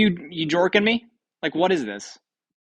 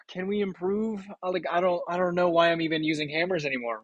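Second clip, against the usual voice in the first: ordinary delivery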